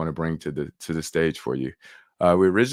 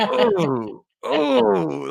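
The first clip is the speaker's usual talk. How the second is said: high, loud caught-out oh; mock dismay; 13 semitones higher, 5 dB louder than usual. Host relives being called out, mock dismay. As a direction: yelp as if caught out. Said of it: "I was like".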